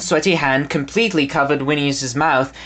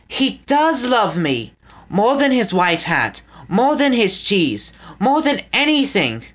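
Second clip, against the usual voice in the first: joyful; 6 semitones higher than usual. A narrator's reading. Winnie's joyful realization.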